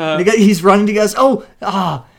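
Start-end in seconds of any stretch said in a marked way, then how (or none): 1.2-2.0 mock winded runner; imitated heavy breathing. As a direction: huff as if spent from running. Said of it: "So Breathe Hard's like".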